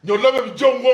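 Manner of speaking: a touch of the father's dismissive retort. Retold or imitated. Father brushing off mother's gossip, light mock voice. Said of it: "Vous savez ce que mon père lui a dit?".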